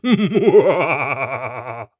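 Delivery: loud jeering laugh; a thug's loud taunting laugh; mocking laugh. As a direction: cackle in her face. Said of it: "laughing in her face".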